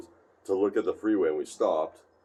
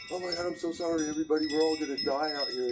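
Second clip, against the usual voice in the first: freaking out; 9 semitones higher, faster than usual. Retold or imitated. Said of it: "So he starts freaking out".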